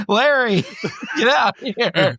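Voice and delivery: shouting a name through laughter; laughing mock command. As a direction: call the private out while laughing. Army officer calling soldier.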